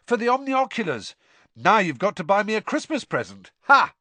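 high, gleeful boast ending in a loud "Ha!"; happy yell. A narrator's reading. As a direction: crow it in triumph. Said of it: "Ron yelled happily".

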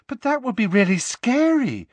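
put-on high, light girl's voice; girlish high voice. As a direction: pitch up into a young girl. Lavender voiced by narrator.

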